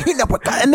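high, garbled mock Northern English; gibberish imitation. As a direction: imitate baffling Northern British babble. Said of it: "I had never fucking clue what".

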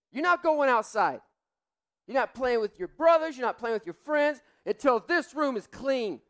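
loud, high, furious yelling at his son; angry father scolding. Retold or imitated. Dad yelling at son Dane to clean his room, furious. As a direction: scold the boy hard and loud.

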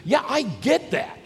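high, loud, impatient retort; worked-up, emphatic comeback; intense. Retold or imitated. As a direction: fire it back, loud and insistent. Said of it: "Martha, being the intense one".

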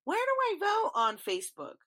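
high, mocking dumb question; mock a clueless voter. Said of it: "ignorant assholes questioning".

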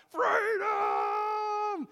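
huge high shouted cry; prayer meeting member bellows Freedom. Retold or imitated. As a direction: belt it out like a battle cry. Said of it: "someone who would get up and cry out".

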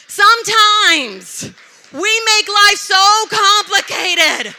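slow, high, very loud preaching; booming exclamation. Real, not acted. Preacher crying out moral to congregation, slow loud exclamation. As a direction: cry it out, stretching every word.

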